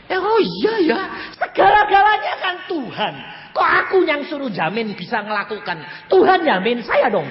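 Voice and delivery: high, loud re-enacted protest; excited acted objection to God; sudden realization. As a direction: burst out as it dawns on you.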